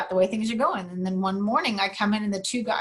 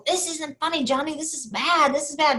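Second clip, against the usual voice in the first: higher, mildly frantic retold plea; worried protest; 6 semitones higher than usual. Workers scolding their laughing boss, mock worry. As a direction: imitate anxious workers insisting it's serious.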